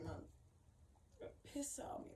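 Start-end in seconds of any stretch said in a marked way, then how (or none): 1.2-2.1 bitter whisper. Real, not acted. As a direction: whisper it as if to yourself, hurt.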